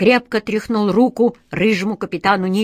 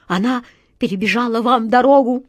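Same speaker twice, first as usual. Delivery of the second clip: trembling voice; 5 semitones higher, 4 dB louder than usual. A narrator's reading. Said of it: "дрожащим голосом еле выговорил он".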